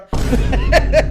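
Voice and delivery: breathy chuckle; real laugh at a wild true story; amused laugh. Real, not acted. Laughing over toy-fight memories, amused chuckle.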